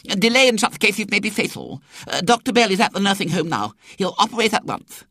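high, quick pompous doctor voice; self-important young doctor. A narrator's reading. Young doctor urging an operation, self-important. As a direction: put on an officious, high-pitched doctor. Doctor's urgent verdict in the story.

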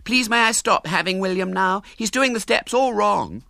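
put-on prissy girl whining to the teacher, high; complaining little girl. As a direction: tell on him like a prissy girl. Girl complains at dancing class.

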